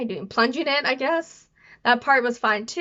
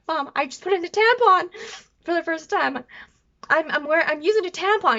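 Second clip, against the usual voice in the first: higher-pitched, excited announcement; retold excitement; 6 semitones higher than usual. Daughter texting mom about her first tampon, excited. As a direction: act out proudly breaking big news. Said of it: "I was so excited".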